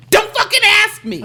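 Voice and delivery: sharp and high, thrown out in a flash of irritation; half-laughing snap of the thought you'd never say aloud; snappy inner outburst. Retold or imitated.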